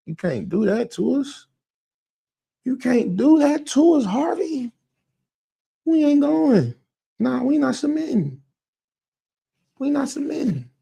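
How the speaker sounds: high, drawn-out mock protest; indignant refusal. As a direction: refuse in a high, wounded whine. Acting out BTS refusing [name].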